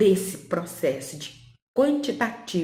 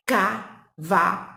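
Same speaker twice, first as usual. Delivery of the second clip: cavalo said slowly, syllables pulled apart; sounded out slowly; 3 dB louder, much slower than usual. Shown as a demonstration. Teacher shows how the child hears the word, slow. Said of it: "Olha aqui".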